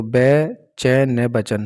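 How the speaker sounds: "बै चै ने" drawn out slowly syllable by syllable, then "बचन"; slow spelling out. Shown as a demonstration. Teacher spells out syllables slowly for students. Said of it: "बै आप ये भी लिख सकते हो".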